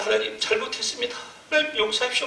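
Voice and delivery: high pleading cry for forgiveness; wailing repentance. Retold or imitated. Pastor begging God for forgiveness, high wailing cry. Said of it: "통곡이 나오는데".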